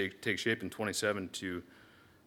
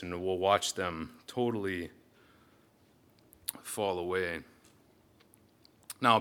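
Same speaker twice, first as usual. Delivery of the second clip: ordinary talk, nothing marked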